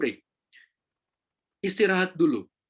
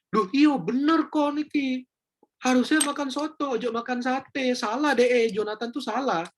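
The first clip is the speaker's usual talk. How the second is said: mock siding gossip; 8 semitones higher than usual. Listener siding against Jonathan, high eager gushing. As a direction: gush that the friend is right.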